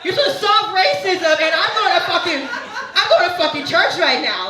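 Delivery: high-pitched, indignant comic rant; mock outrage. Comedian ranting about church on a day off, high mock outrage. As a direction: protest far up in pitch, exasperated.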